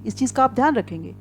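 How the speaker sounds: ordinary talk, nothing marked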